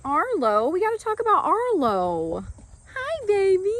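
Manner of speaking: high excited pet voice. Real, not acted. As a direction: gush over the foal. Greeting foal Arlo.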